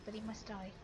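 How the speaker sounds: normal talking voice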